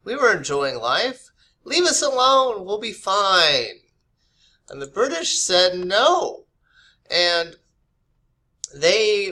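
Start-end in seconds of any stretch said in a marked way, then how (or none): 1.6-3.8 higher, louder mock plea from the colonists; colonists' plea. Colonists telling the British to leave them alone, a higher mock plea. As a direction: voice the colonists brushing off the Crown.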